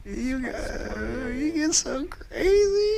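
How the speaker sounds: very high, slow mocking squeal; high-pitched crazy voice. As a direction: imitate a friend's squeaky outburst. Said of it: "how Cheese's voice gets when he gets all pumped up".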